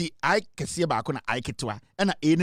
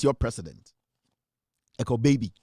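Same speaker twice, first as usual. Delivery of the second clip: ordinary talk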